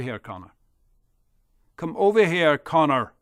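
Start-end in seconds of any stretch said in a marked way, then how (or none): 1.8-3.1 louder, harsh command; loud bully order. Acted. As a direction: bark him over. Dirk orders Connor.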